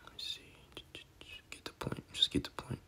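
breathy whisper, under his breath; a private whispered reminder to himself; whispered aside. Real, not acted. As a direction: whisper it to yourself while flipping pages. Muttering to himself while searching verses.

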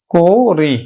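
করি drawn out as "ko-ri"; closed o. Shown as a demonstration. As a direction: round the first vowel of করি to o. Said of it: "সংবৃত উচ্চারণে". (করি-র ক সংবৃত "কো" উচ্চারণ)

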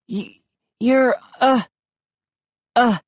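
feeble stammer with long gaps; weak, halting. A narrator's reading. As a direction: struggle to get words out. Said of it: "stopped and coughed weakly".

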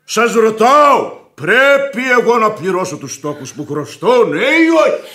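loud, insistent, pressing for an answer; angry demand. Acted. Creditor shouting at the widow, angry demand. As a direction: demand it loudly, ending high. Heated quarrel over debt.

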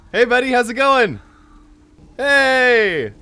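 high, loud hailing; calling out. Real, not acted. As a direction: hail a stranger across a distance. Calling out to another player.

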